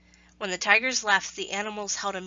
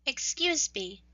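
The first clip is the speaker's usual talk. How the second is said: little mouse; 6 semitones higher, slower than usual. Acted. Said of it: "a little mouse said".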